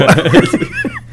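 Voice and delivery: sudden squeal of laughter, way up high; burst of high laughter. Real, not acted. Host cracking up at his own joke, high squealing laugh. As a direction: let a high giggle burst out.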